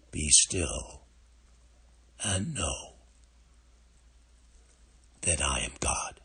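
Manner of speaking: hushed scripture, fading to whisper. Real, not acted. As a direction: let it fall to a breath. Quoting scripture in meditation.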